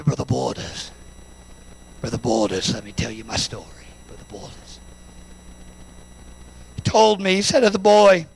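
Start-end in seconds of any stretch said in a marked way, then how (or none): 0.0-4.8 very low, husky New Zealand voice; husky Kiwi accent. Retold or imitated. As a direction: imitate a big Kiwi man, low and soft. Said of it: "husky Kiwi accent".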